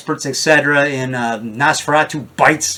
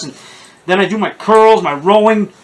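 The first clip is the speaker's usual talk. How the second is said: normal talking voice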